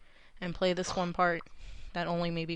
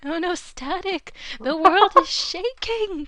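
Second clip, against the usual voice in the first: mock panic; 11 semitones higher, 10 dB louder, slower than usual. Mock panic at mic noise.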